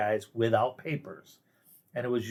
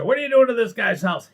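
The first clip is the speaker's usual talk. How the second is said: higher, louder mock confrontation; imagined yell at cops; 10 semitones higher, 6 dB louder than usual. Imagined neighbor confronting police raid, mock yell. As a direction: shout it at the officers as a hypothetical.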